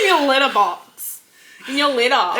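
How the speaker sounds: playful mock Aussie; put-on Australian pet talk; Australian accent. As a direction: draw out Australian vowels on "litter". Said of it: "doing that accent".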